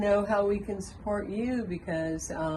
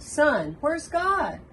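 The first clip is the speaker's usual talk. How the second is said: pressing preacher; 9 semitones higher, 5 dB louder, much slower than usual. Retold or imitated. Preacher presses third time.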